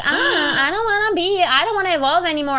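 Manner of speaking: high whiny complaining voice. Retold or imitated. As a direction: whine like a stubborn kid. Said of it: "but you're not like".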